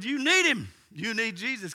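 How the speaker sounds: high, loud insistence; urgent exhortation. Retold or imitated. Pastor urging the woman toward Jesus, loud. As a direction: press it home forcefully.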